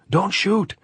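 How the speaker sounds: loud high call into the dark; shout. A narrator's reading. Andres hailing the government lines, loud high shout. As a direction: shout up at the parapet. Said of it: "Andres shouted".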